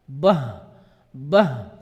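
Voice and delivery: Bah dropped very low and pushed hard; heavy wrong bah. Shown as a demonstration. Teacher mimics the wrong thick bah. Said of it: "itu salah".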